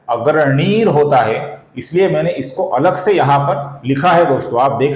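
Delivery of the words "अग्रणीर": अग्रणीर ending in a clear r; visarga as r. Shown as a demonstration. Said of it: "इसका र होता है". (विसर्ग को र बोलते हुए अग्रणीर)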